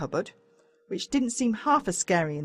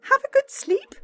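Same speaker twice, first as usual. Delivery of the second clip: much higher motherly voice; Mum's voice; 5 dB louder than usual. A narrator's reading. Mum asking her son at breakfast, much higher. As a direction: sing it up like a fond mum.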